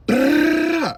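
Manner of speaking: loud, high rattling burst; imitated gunfire. Telling of the shooting nearby, loud rattling gunfire sound. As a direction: mimic a rapid spray of shots.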